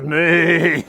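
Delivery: a hint of a horse saying nay; horse whinny voice. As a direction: talk like a horse. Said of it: "you start talking like this".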